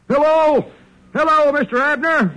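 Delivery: loud, high yell down a long-distance line; loud high phone shout. Acted. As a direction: holler so Texas can hear. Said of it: "you don't have to shout".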